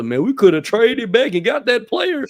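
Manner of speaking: high, loud whiny mock of a fan; mocking mock-draft fan. Host mocking mock-draft fans with a whiny fan voice. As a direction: parody the know-it-all drafter. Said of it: "if I had to hear another".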